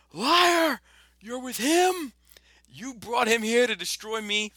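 high, loud screamed accusation, slowing at the end; Anakin yelling. Retold or imitated. Anakin screaming at Obi-Wan, high and loud. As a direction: scream it like a betrayed Jedi. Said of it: "and he goes".

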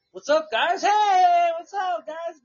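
high, loud, overly friendly hello; cheery shouted what's up; big smiley greeting. Retold or imitated. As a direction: beam and greet them like old pals. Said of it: "Big smile and shit".

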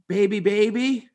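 put-on high sing-song little kid chanting; toddler voice. Nanny imitating the toddler's high chant. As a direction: mimic a toddler chanting at the stairs. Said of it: "he goes".

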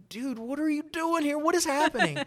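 very high-pitched bewildered questioning; squeaky-high retold astonishment; stunned disbelief. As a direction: act out baffled shock, pitch way up. Acting out stunned question to Chris.

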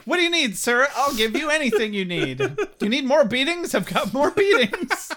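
high, loud, eager offer with laughter creeping in; hammed-up eager dad; mock over-eagerness. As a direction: fall over yourself to help, half laughing.

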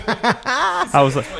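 laughing through slow, high words; real laugh over I was like. Real, not acted. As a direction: break into laughter while talking.

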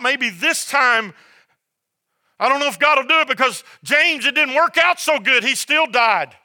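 high, forceful pulpit delivery; fired-up sermon voice; impassioned preaching. Real, not acted. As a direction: preach it hard with emphatic, punched phrases.

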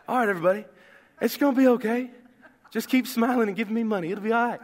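a breezy, lightly put-on televangelist; Joel Osteen impression. Pastor mocking a prosperity preacher in a breezy voice. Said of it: "Feel like Joel Osteen".

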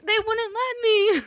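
retold helpless plea in a squeaky-high voice; pleading excuse. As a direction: plead your innocence, stretching it out. Retells pleading excuse to daughter.

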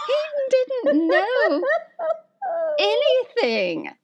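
high-pitched, laughing through the words. Real, not acted. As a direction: squeal it out in laughing disbelief. Laughing disbelief retelling husband.

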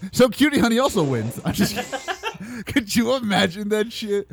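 high, loud, laughing through the words. Real, not acted. Host jokes the worst show also wins, laughing. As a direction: crack up at your own joke.

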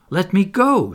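high, loud sharp command; ordering. A narrator's reading. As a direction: order him to release you. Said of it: "she ordered him".

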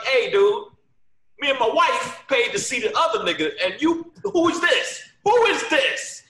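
loud, high mock yell building; yelling from a car. Driver yelling at the comic from his car, loud and high. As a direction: shout it out the car window. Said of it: "This dude yelled out the car".

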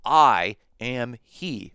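"I am he" very slow and loud, word by word; emphatic. Shown as a demonstration. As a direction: punch out "I am he" one drawn-out word at a time. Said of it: "for emphasis".

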